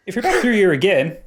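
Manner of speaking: slipping into an Irish lilt; the dwarf accent drifting Irish; a GM losing his dwarf voice into Irish; accidental Irish accent. Acted. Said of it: "no, it's gone Irish".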